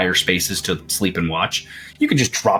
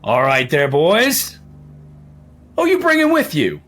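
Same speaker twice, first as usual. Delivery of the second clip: Hagrid voice; 5 semitones higher than usual. Acted. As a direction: boom out a half-drunk welcome. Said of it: "[name] is now Hagrid".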